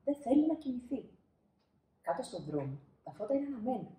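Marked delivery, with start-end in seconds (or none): none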